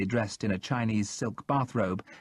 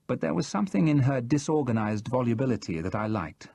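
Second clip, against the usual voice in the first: ordinary delivery, nothing marked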